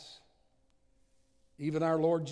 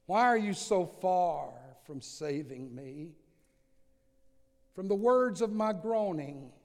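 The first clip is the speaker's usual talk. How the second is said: preaching scripture; 2 semitones higher, 4 dB louder than usual. Acted. Preacher reads the psalm to the congregation, impassioned and loud. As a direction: pour out the psalm like your own cry.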